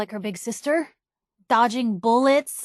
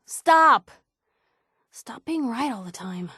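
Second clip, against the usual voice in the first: exasperated plea to stop. Acted. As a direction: burst out, fed up but fond. Exasperated at Blue being right.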